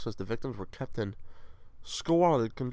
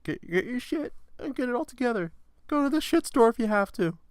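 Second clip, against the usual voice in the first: high-pitched put-on telling-off; mock scolding; 3 dB louder than usual.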